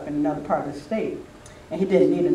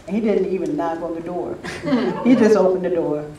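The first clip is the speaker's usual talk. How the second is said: ordinary talk, nothing marked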